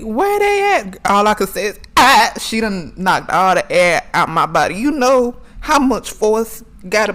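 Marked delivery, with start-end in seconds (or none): 0.0-1.0 high, loud mock yell; grandma yelling. Grandma yelling mid-whipping at her grandson, loud mock yell. 2.0-2.3 loud high yelp of pain. Retold or imitated. Child hit by grandma's belt, loud pained yelp.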